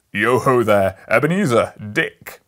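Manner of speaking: put-on loud, rich, jolly old man calling out; jovial call. A narrator's reading. Fezziwig hails his apprentices in a jolly shout. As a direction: boom it out like a fat, merry master. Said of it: "called out in a comfortable, rich, oily, fat, jovial voice".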